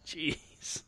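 breathy whispered sigh; whispered jeez. Retold or imitated. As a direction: mutter it under your breath. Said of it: "It's like".

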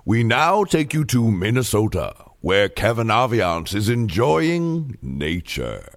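low, measured scene-setting voice-over; mock documentary narration; announcer voice-over. Sets the sketch scene.

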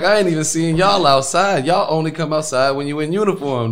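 mock call-out. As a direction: throw it at them loudly, half joking.

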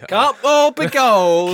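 high, loud, drawn-out prospector cry; bad prospector. Host playing the worst prospector in a mine, hammed-up holler. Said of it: "like, the worst prospector".

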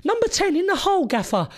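put-on high Welsh accent; put-on Welsh voice, high and self-assured; Michael Flynn. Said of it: "this is how he used to talk".

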